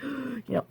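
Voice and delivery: sharp gasp for breath, imitating husband; gasping for air. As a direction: mimic running out of air mid-sentence. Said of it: "he's always like".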